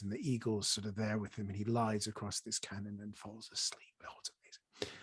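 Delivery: hushed, breathy whisper of wonder; awed whisper. Real, not acted. Describing the sleeping boy and eagle scene, awed whisper. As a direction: drop to a near-silent, awed whisper.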